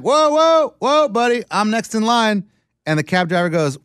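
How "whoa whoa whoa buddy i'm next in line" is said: higher, louder whoa whoa; mock protest. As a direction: stop the line-cutter, mock indignant. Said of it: "And I'm like".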